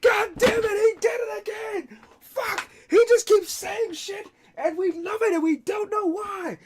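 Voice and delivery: very high crying shout; high, loud sob-yelling fan; ecstatic fan. Acted. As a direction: lose it with joy over the star. Overjoyed fan yells and sobs.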